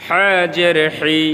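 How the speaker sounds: 'হা' and 'হি' squeezed from the throat; pressed throat H. Shown as a demonstration. Teacher drills the letter for learners, 'হি' pressed in the throat. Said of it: "pressure দিয়ে".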